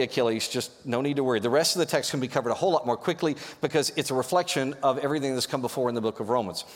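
usual speaking voice

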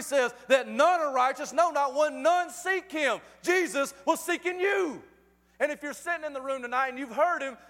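fervent sermon climax; impassioned preaching. Real, not acted. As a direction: preach hard, hammering the key words. Preaches at full force.

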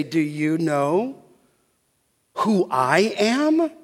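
slow, measured counter-question, lightly acted; Jesus. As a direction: turn the question back on him, slowly. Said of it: "Jesus is gonna turn the table on him".

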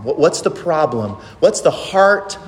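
normal talking voice

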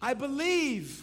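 loud, high call for the congregation to echo; desperate cry. Acted. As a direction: cry it out as a shared prayer. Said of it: "cried out".

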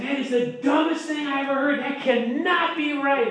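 high-pitched mock outrage at a friend; indignant outburst. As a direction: blurt it out like a hothead refusing to listen. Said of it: "I jumped up and I said".

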